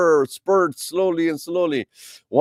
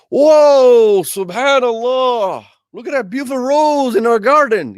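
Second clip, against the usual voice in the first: much higher, louder delighted gasp of wonder; amazed; 10 semitones higher, 7 dB louder, slower than usual. Pretend. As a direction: marvel at a rose that bloomed overnight. Gardener amazed the rose bloomed.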